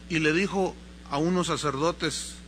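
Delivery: ordinary delivery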